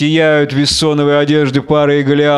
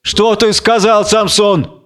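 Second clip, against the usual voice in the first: startled, higher-pitched question; a touch of fright in a raised voice; frightened; 7 semitones higher, slower than usual. A narrator's reading. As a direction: ask it in alarm. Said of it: "пугаясь".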